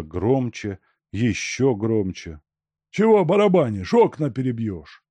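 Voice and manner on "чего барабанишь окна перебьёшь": loud, high grumbling rebuke; snappish. A narrator's reading. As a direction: snap at the noisy knocker. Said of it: "огрызнулся дворник". (огрызаясь, громко и раздражённо)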